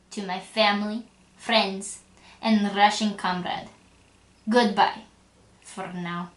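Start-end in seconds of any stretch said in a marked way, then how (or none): none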